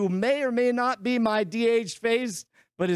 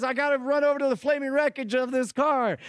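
ordinary talk

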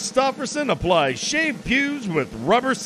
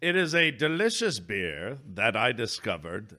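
Matt Berry voice; 8 semitones lower, 5 dB quieter than usual. Pretend. Host sipping the beer, deep rich Matt Berry voice. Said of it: "I don't know why I'm talking like this".

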